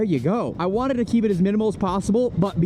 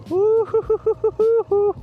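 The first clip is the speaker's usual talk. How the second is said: excited squeal; 3 dB louder than usual. Real, not acted. Riding, thrilled by the screen.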